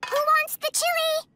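chirpy high voice. Acted. Starfire offers chili.

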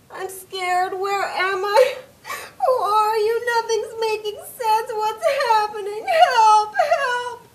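high, sobbing pleas; terrified crying. Acted. Mary, lost and scared, cries out for help, high and sobbing. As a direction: break down calling for help.

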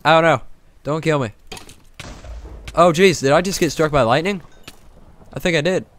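loud, high-pitched panic at the game; alarmed yelling. Real, not acted. Gamer under attack in a storm, loud alarm. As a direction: yelp it out as danger hits. Attacked, then struck by lightning.

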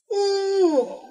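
a short pained whimper; aching elderly mother's whimper. Acted. Mother in pain, cry heard.

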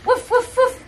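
high, short dog barks; dog woof. Shown as a demonstration. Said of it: "What's the dog say?".

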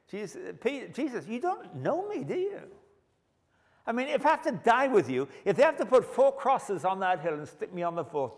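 high, loud, fast bragging; overconfident boast. Acted. Preacher mocking Peter boasting to Jesus, high and fast. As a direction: brag it like a cocky teammate.

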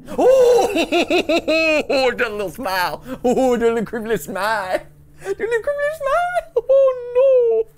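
high laughing shriek of fright; freaked out. Real, not acted. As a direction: squeal in scared delight. Freaked out by creepy smile.

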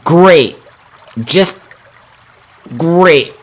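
loud, high sarcastic groan. Real, not acted. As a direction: spit it out sarcastically after dying. Dies in the game.

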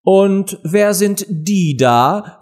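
high, slow queenly demand; imperious queen. A narrator's reading. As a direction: question them as a haughty queen, drawn out. Queen interrogates, voiced in a story. (herrische Königin)

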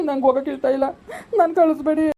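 put-on high, upset child's plea; distressed pleading. Child begging parents not to send them off, distressed pleading. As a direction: beg not to be sent away, pitch climbing.

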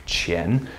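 QIAN low and soft, rising; rising pitch on QIAN; second tone. Shown as a demonstration. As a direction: lift QIAN from low like a question. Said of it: "Second tone though".

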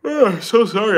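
apology stretched through a yawn; sleepy streamer apologizing mid-yawn. Real, not acted. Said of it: "I can't stop yawning".

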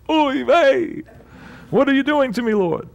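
high, exasperated Yiddish kvetch; yiddish accent. Retold or imitated. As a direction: whine it up to the Lord like an old Yiddish uncle. Moses complains to God.

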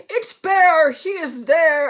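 loud, high excited cry; squawking. A narrator's reading. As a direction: squawk the discovery from above. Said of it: "all squawk from the sky".

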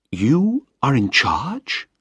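Penny learning Malcolm leads the operation, slowed in shock; stunned disbelief. Acted. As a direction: let the surprise sink in slowly.

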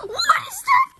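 very high, loud squeal of disbelief; astonished. Acted. Friend who never heard of the Nintendo Switch, astonished. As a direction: shriek the question in bafflement. Baffled by an unknown console.

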